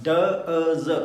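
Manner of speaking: डज split slowly into ड, अ, ज; teacher breaking डज into ड अ ज; sounded out. Shown as a demonstration. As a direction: spell out डज sound by sound, very slow. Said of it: "पहले तो डज बोलो".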